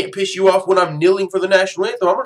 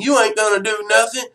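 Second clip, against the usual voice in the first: higher-pitched put-on country accent; 6 semitones higher than usual. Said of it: "give him a country accent".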